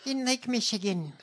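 high, put-on anecdote voice; parody politician voice. Co-host spoofing her constituent story, high politician voice.

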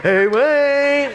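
high, drawn-out call through a door; pestering late-night holler, high and held long; Mike calling out. Acted. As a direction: call the name in a loud, stretched singsong. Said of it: "then I hear this voice".

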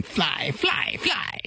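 high-pitched mock witch call; Wicked Witch cry. Guest recalling the witch's order to the monkeys, high imitation. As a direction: imitate the witch crying out, pitch leaping upward. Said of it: "I remembered the witch going".

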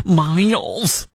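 put-on Michigan accent on Miles. Radio host repeats the owner's name, Michigan accent. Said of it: "that's my Michigan accent".

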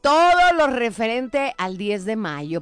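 loud, high, big radio greeting; upbeat radio host intro; radio show opening. Acted.

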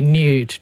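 "nude" said as NYOOD; y-glide pronunciation. Shown as a demonstration. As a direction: put a y after the n in "nude". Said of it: "rather than nude".

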